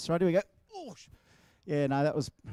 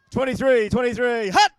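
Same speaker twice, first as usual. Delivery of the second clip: loud, higher snap count shouted to the crowd; quarterback snap call; 6 semitones higher, 9 dB louder than usual. Pretend. Pretend quarterback shouting the snap count to the crowd. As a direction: call the snap like it's a real game. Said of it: "just pretend I'm the quarterback".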